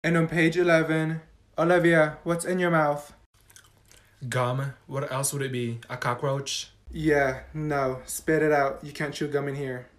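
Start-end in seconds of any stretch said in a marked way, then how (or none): 4.3-9.9 stern teacher. Acted. Teacher scolding quietly.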